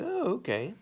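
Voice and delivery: lightly surprised shrug; mock surprise. Boy reacting to the rock moving, mock surprise. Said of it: "And I was like".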